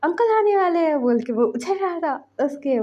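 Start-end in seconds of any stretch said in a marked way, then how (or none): 0.0-1.0 high, loud delighted cry; excited child. Acted. Child hearing uncle is coming, excited squeal. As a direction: squeal with joy at the news. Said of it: "जिंगी खुश".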